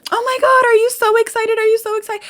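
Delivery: friends' excited gush. Retold or imitated. Mimicking friends who gush over her Lotto DM, high-pitched and excited. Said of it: "my friends were all like".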